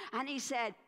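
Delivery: plain reading voice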